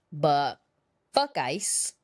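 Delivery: ordinary talk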